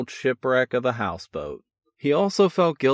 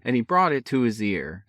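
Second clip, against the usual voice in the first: ordinary delivery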